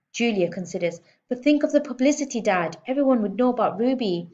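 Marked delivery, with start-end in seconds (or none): 1.3-4.3 fast, higher, louder pleading; persuasive. A narrator's reading. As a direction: sell dad on the idea. Girl persuading dad.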